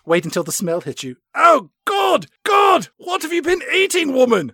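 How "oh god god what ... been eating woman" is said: much higher, shouted disgust. Pretend. Bond yelling at M about the smell, hammed-up disgust. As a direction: yell in mock revulsion at the stink.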